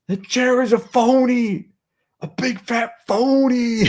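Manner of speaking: high, loud and slow, hammed-up outrage; mock blustering pundit. Streamer mocks critics of his bison chair, hammed-up high slow rant. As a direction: ham it up like a ranting TV blowhard.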